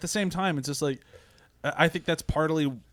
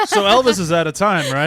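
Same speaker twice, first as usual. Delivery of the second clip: words squeezed through real laughter; laughing loudly while talking; 6 semitones higher, 11 dB louder than usual. Real, not acted. Group laughing at joke.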